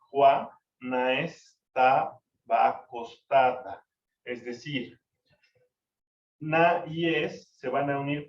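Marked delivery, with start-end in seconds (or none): 0.1-3.8 "Juana estaba acostada" read slowly, with na-es and ta-a each glided into one syllable; sinalefa reading. Shown as a demonstration. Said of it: "Y entonces queda así".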